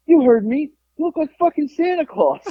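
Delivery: high-pitched retold heckle from the biker; defiant taunt. Biker stands by his insult to the customer, mock defiant taunt. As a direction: double down on the insult, imitating him.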